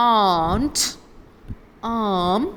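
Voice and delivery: "aunt" and "arm" with a loud long ah and no R. Shown as a demonstration. Teacher modelling words for students: long ah, R silent. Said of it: "കേട്ടില്ലേ? ആർ sound pronounce ചെയ്യില്ല".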